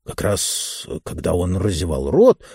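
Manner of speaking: usual speaking voice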